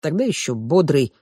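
ordinary delivery, nothing marked

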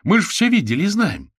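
high, loud protest; indignant. A narrator's reading. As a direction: object hotly, pitch way up. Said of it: "возмутился Кузя".

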